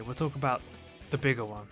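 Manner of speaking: ordinary delivery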